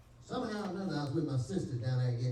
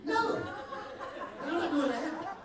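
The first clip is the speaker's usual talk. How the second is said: high laughing sister's refusal; shocked refusal; 14 semitones higher, slower than usual. Retold or imitated.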